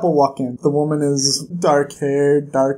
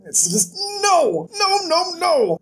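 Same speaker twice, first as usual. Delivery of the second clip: high anguished no; 12 semitones higher than usual. Real, not acted. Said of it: "vlogger's own anguish".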